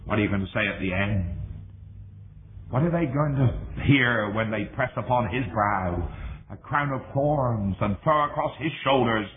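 ordinary talk, nothing marked

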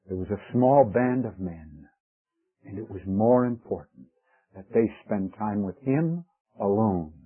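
ordinary talk, nothing marked

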